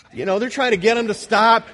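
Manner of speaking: normal talking voice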